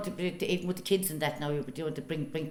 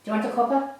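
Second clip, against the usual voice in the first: loud, high, chatty neighbour; Irish countrywoman in a drama; 5 semitones higher, 10 dB louder than usual. Acted. Neighbour chatting with the shopkeeper in the drama, loud and cheerful. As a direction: play the cheerful village neighbour. Acted drama scene before interview.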